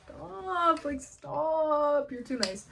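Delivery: high, drawn-out mock-bashful protest; hammed-up coy squeal, easing down; coy flattered. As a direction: play at being embarrassed by compliments. Acts coy reply to praise.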